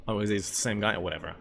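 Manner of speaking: usual speaking voice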